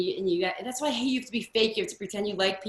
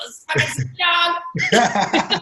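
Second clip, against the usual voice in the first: louder, higher mock freak-out through laughter; starstruck outburst; 8 semitones higher, 6 dB louder, much slower than usual. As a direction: gush it slowly, laughing at your own awe. Retells being starstruck meeting [name].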